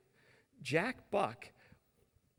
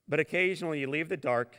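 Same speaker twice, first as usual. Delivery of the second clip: ordinary talk, nothing marked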